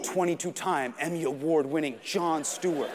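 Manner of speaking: low, grand recital of honours; Jon Stewart. Acted. Accomplishments heard in each step, low grand voice. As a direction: proclaim it low, like a mighty figure. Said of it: "I can hear his accomplishments in each step".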